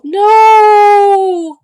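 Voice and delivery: loud high shout; desperate no. Real, not acted. Protesting that the scene won't end, full shout. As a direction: scream it out in protest. Begging the character not to leave.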